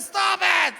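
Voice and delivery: high, loud mock shriek; hammed-up high, loud cry; upset yell. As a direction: shout it in pleading protest. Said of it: "she's crying more".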